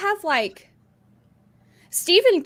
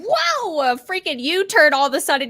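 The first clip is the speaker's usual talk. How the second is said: acted shock; 6 semitones higher, 4 dB louder than usual. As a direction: jolt upward as if caught off guard. Acts sudden shock during movie.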